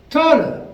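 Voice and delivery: taller jumps high and loud; stress on taller. Real, not acted. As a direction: punch the word taller. Emphatic point on stair height.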